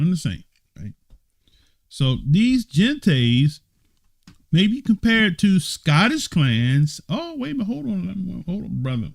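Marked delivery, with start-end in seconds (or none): 1.9-7.0 so these gentes drawn out at half speed, then pitched up; slow emphatic reading. Shown as a demonstration. Teacher rereading the line for his class, slow and emphatic. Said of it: "Let's read that again". 7.1-9.1 high-pitched, softer oh wait a minute; exaggerated double take; mock surprise. Real, not acted. Teacher catching a surprising word, high mock surprise.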